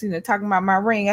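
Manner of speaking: usual speaking voice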